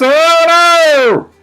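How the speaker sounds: high, loud dismayed oh no; kitty's oh no. Acted. Kitty dismayed at the food list, high wailed oh no. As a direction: wail oh no in cartoon horror. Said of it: "says the kitty".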